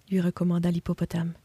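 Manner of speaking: usual speaking voice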